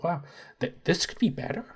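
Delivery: high, soft, musing; a dork mumbling appreciation; soft high Pratt voice. Acted. Pratt musing over the fish, high and soft.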